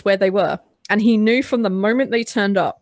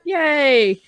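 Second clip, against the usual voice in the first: higher-pitched mock cheering; happy, excited; 7 semitones higher than usual. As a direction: imitate a crew whooping with delight. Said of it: "they get really happy and excited".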